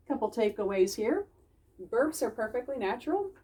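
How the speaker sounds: ordinary delivery, nothing marked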